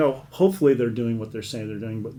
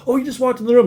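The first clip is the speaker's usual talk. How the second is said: higher, louder mock alarm; sudden alert; 11 semitones higher, 7 dB louder, faster than usual. Wife on a hotline call as her husband appears, retold startled alert. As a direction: imitate a wife's startled interjection.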